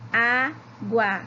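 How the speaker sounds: "Água" split into á, gua; slow syllable by syllable. Shown as a demonstration. Teacher checking syllable counts with pupils, "Água" slowly split. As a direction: say "Água" as two separate, held syllables. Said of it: "Vamos falar todas devagarinho".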